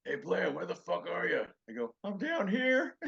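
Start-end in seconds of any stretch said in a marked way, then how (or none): 0.0-1.4 high, put-on voice calling out; imitated comic's unmistakable voice, raised; George Carlin. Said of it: "George's unmistakable voice going". 2.0-3.0 high, loud mock holler; acted call to someone on another floor. Answering a call from upstairs.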